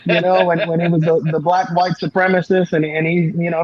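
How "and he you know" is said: usual speaking voice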